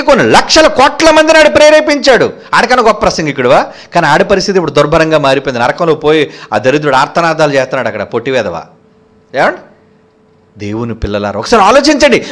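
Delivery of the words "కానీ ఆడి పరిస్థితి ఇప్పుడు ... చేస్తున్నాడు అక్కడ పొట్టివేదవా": ordinary delivery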